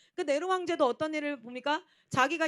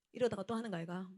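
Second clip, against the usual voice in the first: normal talking voice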